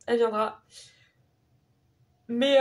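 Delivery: ordinary talk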